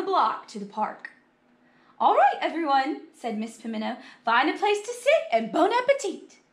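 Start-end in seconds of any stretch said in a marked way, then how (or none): 2.0-3.0 high, loud rallying call; teacher calling out. Acted. Teacher addressing class at the park, calling out. 4.2-6.4 high, loud, cheery instruction; teacher calling out. Acted. Teacher seating the class for picnic, cheery call.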